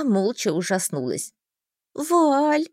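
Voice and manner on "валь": high, stretched-out call; whiny drawl on the name; drawn-out plea. A narrator's reading. Heroine begs the mage, drawling. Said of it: "протянула я".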